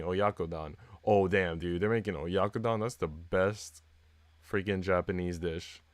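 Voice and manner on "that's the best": usual speaking voice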